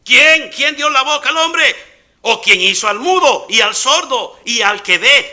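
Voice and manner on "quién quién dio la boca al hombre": ordinary talk, nothing marked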